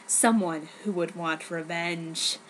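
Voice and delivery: low, slow sinister narration; ominous. A narrator's reading. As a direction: make it a dark cliffhanger. Ominous cliffhanger line.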